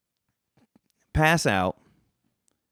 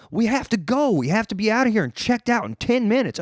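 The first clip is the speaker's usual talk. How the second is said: higher, pressing friend hurrying him out; urgent insisting; 8 semitones higher than usual. Retold or imitated. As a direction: push him to move now.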